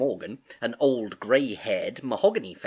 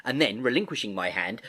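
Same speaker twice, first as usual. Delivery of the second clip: ordinary delivery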